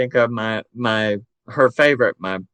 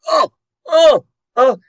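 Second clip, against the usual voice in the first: mock whimpering; 13 semitones higher, 7 dB louder than usual. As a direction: whimper like a child dreading the spoon. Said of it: "my brother's sitting there going".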